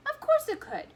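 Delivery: put-on high, loud boyish cry; excited. A narrator's reading. Boy answering the candy maker, high and excited. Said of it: "boy's eager reply".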